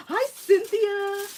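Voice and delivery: high, loud, drawn-out hello; slow, high-pitched welcoming squeal; excited greeting. Real, not acted. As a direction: greet a friend who just walked in. Greets viewer joining the stream.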